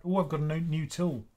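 usual speaking voice

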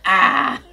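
loud breathy "Ah" with no voice; breathy sigh. Retold or imitated. Voicing Blue's airy sigh after drinking. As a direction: let out a satisfied, airy "Ah". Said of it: "He's like".

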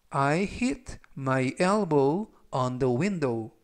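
very slow, every word spaced for learners; slow read-aloud. Shown as a demonstration. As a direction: read it slowly for students to repeat. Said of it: "제가 역시 천천히 세 번씩 읽어드리고요". (따라 읽기 좋게 아주 천천히)

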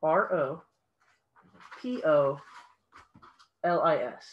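spelling letters with pauses; dictating. Shown as a demonstration. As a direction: read each letter out clearly. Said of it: "Would you like me to spell that for you?".

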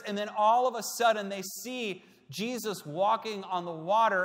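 loud, high, driving sermon build; preaching at full force. Acted. Preacher to congregation, building the storm scene loudly. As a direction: preach the storm rising, pushing harder.

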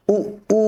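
high-pitched "উ", first brief, then stretched; short then long. Shown as a demonstration. Teacher drilling learners, short then long "উ". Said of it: "একসাথে practice করব এভাবে".

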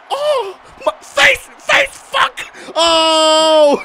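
high, loud wail of pain; mock pain. Gamer yelling as his fighter gets hit, mock agony. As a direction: cry out as if hit in the face.

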